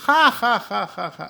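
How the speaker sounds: retold high burst of ha ha; imitated relieved laughter; imitating laughter. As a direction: mimic a friend cracking up. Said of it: "nearly fell off his chair laughing".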